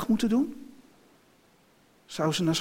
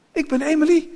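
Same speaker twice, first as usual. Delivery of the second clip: put-on high, loud child's voice; insistent child; 11 semitones higher, 8 dB louder than usual. A narrator's reading. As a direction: insist urgently as the little girl. Kind houdt vol wie ze is. (hoge, luide, aandringende kinderstem)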